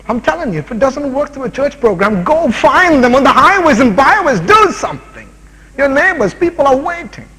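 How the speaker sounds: high, loud exhortation to act now; fiery preaching. Acted. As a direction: preach it out, pushing the flock to move. Preaching at full force.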